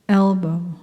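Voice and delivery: ordinary delivery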